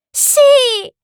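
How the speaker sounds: put-on high, loud childlike cry of delight; joyful exclamation. A narrator's reading. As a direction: squeal it out, full of joy. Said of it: "esclamò pieno di gioia". (grido acuto pieno di gioia)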